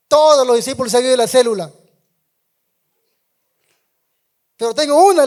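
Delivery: high, loud, upset outburst without sobbing; retold tearful plea. Young leader crying to his pastor, loud and high. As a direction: pour out the bad news to the pastor. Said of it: "me pongo a llorar".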